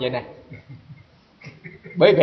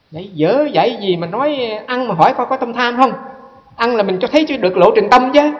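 retold blunt criticism, pitched higher; mock the critic bluntly; blunt critic quoted; 7 semitones higher, 4 dB louder than usual. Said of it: "Trình Pháp ông nói thẳng vậy đó". (giọng chê thẳng thừng nhại lại)

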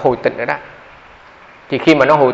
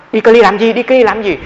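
higher, louder anxious questioning; voicing the worried wife, pitched up; alarmed wife; 7 semitones higher, 4 dB louder than usual. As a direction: press him for an answer in a panic. Wife anxiously asks why travel.

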